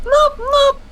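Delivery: high squawky penguin calls; penguin impression. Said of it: "I have a really good penguin impression".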